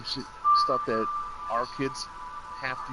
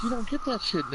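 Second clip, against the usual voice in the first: high-pitched disbelief; amazed; 10 semitones higher, faster than usual. Retold or imitated. Comparing with the U S, high amazed voice. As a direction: sound floored by what you see. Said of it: "I'm like".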